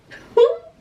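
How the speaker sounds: a short squeaky burst of laughter; real giddy laugh; high giddy laugh. Real, not acted. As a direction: laugh in sheer delight. Overwhelmed by the twist.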